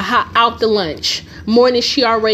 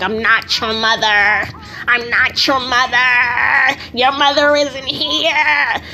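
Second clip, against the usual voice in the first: slow, drawn-out demon voice; mock demon mother; slower than usual. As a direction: stretch every word out like someone playing possessed. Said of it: "doing all this demonic shit".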